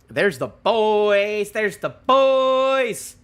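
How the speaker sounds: loud, high, drawn-out cheer; excited cheer. Real, not acted. Fan spotting the boys on the page, excited cheer. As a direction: stretch it out like a hoot for your favorites.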